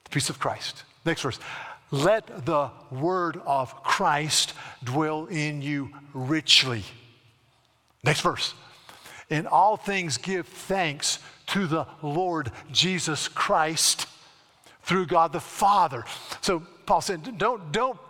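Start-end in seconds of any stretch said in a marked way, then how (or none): 1.9-6.8 slow solemn scripture read. Acted. Pastor reading Colossians to congregation, slow solemn. 9.3-16.0 slow solemn scripture read. Acted. Pastor reading Colossians to congregation, slow solemn.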